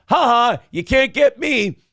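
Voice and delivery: high, loud playground gloat; mock taunt. Host answering a chat jab about weight, gleeful mock taunt. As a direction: crow it triumphantly, drawing it out.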